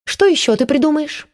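mocking. A narrator's reading. Antsiferov mocks Matvey.

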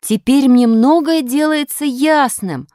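high, loud, drawn-out announcement; loud declaration. A narrator's reading. Grachev declares to nobody in particular, loudly and slowly. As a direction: announce it slowly to the whole room. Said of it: "громко сказал Грачёв".

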